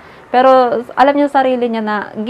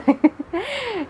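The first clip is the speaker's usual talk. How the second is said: short high laugh; 11 semitones higher, 6 dB quieter than usual. Real, not acted. Laughs at her own joke.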